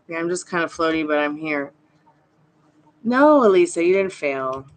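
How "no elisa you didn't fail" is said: emphatic no. Real, not acted. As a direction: jump in to stop her self-blame. Insisting the viewer didn't fail.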